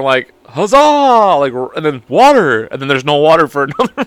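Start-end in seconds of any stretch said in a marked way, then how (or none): 0.4-1.4 huzzah. Retold or imitated. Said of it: "a front flip and going".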